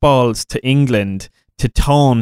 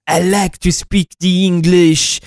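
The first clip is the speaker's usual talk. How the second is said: mock French accent; 3 semitones higher than usual. Mocking the Dauphin's line in a silly French accent. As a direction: do a ridiculous French villain accent. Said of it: "your man's like".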